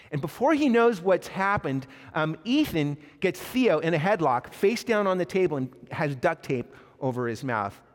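normal talking voice

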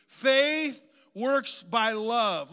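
slow, loud, drawn-out declaration; congregational call-out. Real, not acted. Preacher leads congregation in saying it together, slow call-out. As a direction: lead the crowd word by word, slowly. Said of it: "say that with me".

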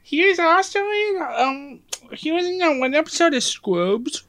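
put-on high, cutesy baby voice. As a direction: talk like a little baby. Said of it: "why do you keep doing this baby voice".

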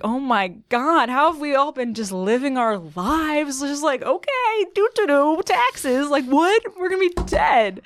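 high, drawn-out incredulous freak-out; mock existential panic. Stoned woman realizing everyone will die, mock panic. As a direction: wail it in rising, exaggerated disbelief.

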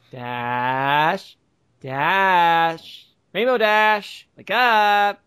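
rising wake-up call; calling to rouse someone. Acted. Acted rousing of unconscious character.

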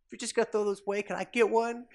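put-on high chirpy girl asking for a gun; eager little girl. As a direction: play the eager kid. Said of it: "the little girl's like".

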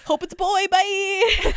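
high, loud comic send-off; mock hasty goodbye. Hamilton leaving pregnant Eliza for war, hammed-up goodbye. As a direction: call it out high as if already leaving.

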